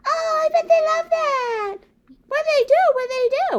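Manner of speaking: high, loud, eager puppet voice with a gasp; excited puppet. Acted.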